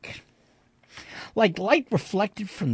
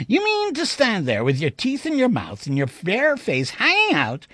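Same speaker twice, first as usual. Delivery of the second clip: higher, rising indignant tirade; scornful disbelief; 8 semitones higher than usual. Acted. Narrator scoffing at the shopkeeper, rising indignation. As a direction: pour scorn on the claim.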